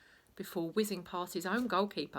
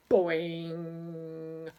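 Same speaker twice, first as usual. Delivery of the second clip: loud drawn-out boing; long boing; 5 dB louder than usual. A narrator's reading. Narrator voices the referee launched, loud boing. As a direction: let the boing ring out. Sound effect.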